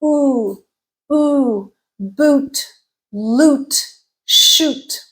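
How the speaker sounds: "boot, loot, shoot" with long rounded oo. Shown as a demonstration. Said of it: "lips are rounded".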